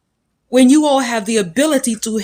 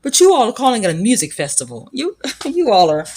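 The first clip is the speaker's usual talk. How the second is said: normal talking voice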